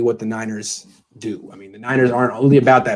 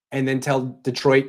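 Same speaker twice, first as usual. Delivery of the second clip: ordinary talk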